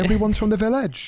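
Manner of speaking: high, lilting mock Welsh accent. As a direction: put on a sing-song Welsh villager voice. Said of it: "they have, like, the accent".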